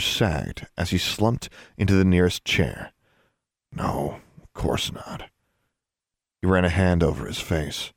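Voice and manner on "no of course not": soft, low, almost voiceless; deflated. A narrator's reading. Brother slumped in a chair backing down, deflated. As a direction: let it out softly, all fight gone.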